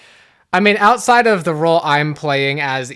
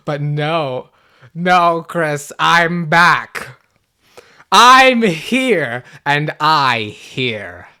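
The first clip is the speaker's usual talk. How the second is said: slow, lower, deliberate declaration; menacing villain; 3 semitones lower, 4 dB louder, much slower than usual. Pretend. Evil role announcing to Chris it is back, slow menace. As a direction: land each word heavily, in control.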